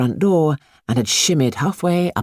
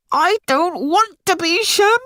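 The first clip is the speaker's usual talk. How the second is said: wailing. Acted. Boy in the bath wails at his mother. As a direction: whine it like a boy refusing the bath. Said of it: "wailed the boy".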